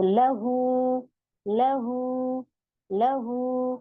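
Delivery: लहू with the हू drawn out very slowly; slow Quranic drawl on लहू; stretched recitation. Shown as a demonstration. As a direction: hold the हू in लहू for two counts. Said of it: "इसके बराबर खींचते हैं इसको".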